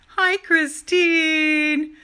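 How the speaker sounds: high, drawn-out happy hello; excited greeting. Real, not acted. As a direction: greet her with delight, slowly. Greeting a viewer by name.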